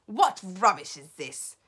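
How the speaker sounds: loud, commanding demand; scornful shout. A narrator's reading. Grand High Witch rejecting the report, shouting. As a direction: shout it with authority. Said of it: "shouted the Grand High Witch".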